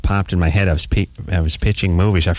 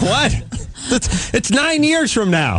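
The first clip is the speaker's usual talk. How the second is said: incredulous; 13 semitones higher, slower than usual. Real, not acted. Mocking the dated movies.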